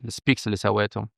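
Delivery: ordinary talk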